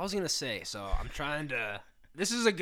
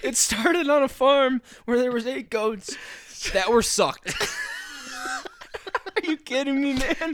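laughing in disbelief; 13 semitones higher, 10 dB louder than usual. Real, not acted. Repeating absurd fact while laughing.

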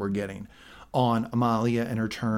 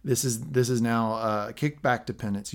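ordinary delivery

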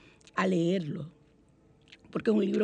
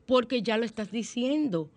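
ordinary talk, nothing marked

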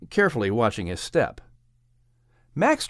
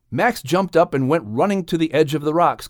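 ordinary delivery, nothing marked

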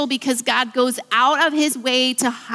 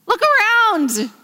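incredulous; 11 semitones higher, 4 dB louder, slower than usual. Pretend. Mock disbelief at God's words.

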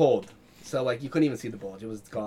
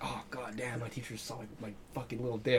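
mock mortified mutter; 2 semitones lower, 11 dB quieter than usual. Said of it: "and I was like".

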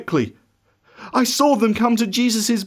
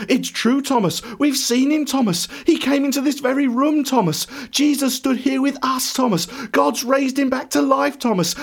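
high, eager overlapping-style insistence; excited clamour; 7 semitones higher than usual. A narrator's reading. Disciples all talking at once to Thomas, excited. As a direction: pile up the assurances in an eager, raised pitch. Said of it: "they all start talking at once".

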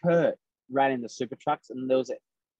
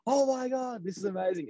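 high, thrilled squeal, reliving it; light mock disbelief, voice way up; excited gasp; 11 semitones higher than usual. Driver told he's on pole, high delighted squeal. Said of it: "and I was like".

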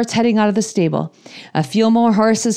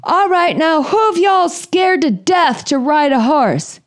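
higher, slow drawling cowboy; slow, higher wrangler drawl; 8 semitones higher, slower than usual. Retold or imitated. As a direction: stretch it out like a folksy trail boss. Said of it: "he's like".

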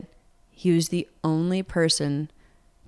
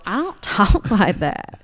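louder, higher, drawn-out country twang; mock her with a really country accent; exaggerated country accent; 4 semitones higher, 5 dB louder, slower than usual. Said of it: "mimic me in a really country accent".

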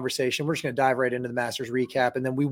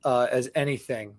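ordinary talk

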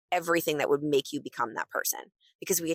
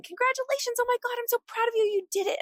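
squealed congratulations, much higher and fast; cheering friends. Retold or imitated. Friends and family at her door celebrating, high fast squeal. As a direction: gush like friends bursting through the door.

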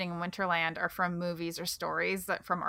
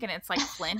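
normal talking voice

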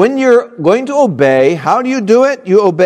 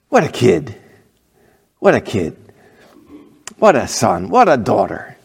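lower, softer delighted murmur; proud parent; 7 semitones lower, 3 dB quieter than usual. Retold or imitated. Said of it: "That's, that makes a parent".

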